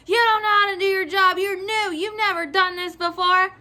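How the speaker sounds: imitated losing it. Clerk mimics customer attacking her competence, irate. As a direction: rattle off the accusations in a mock rant. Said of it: "She fucking loses it".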